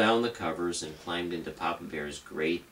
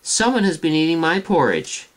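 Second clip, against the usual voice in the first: higher, louder medium-sized bear voice; Mama Bear calling out higher; 9 semitones higher, 8 dB louder than usual. Acted. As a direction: cry out as Mama Bear, pitched up. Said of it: "cried out in her medium-sized voice".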